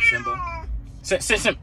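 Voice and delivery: high drawn call. Real, not acted. Calling the crying cat.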